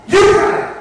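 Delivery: very loud, high shriek; sudden shout. A narrator's reading. Narrator springing the scare on the listeners, loud shriek. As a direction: burst out to make everyone jump. Jump-scare ending of the story.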